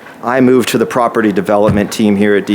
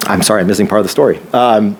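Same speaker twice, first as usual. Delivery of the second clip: ordinary delivery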